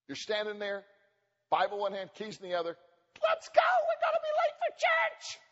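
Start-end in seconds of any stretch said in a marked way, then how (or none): 3.2-5.4 very high squeaky pleading; whiny husband. Retold or imitated. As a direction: whine it like a kid desperate to leave. Husband voiced urging wife.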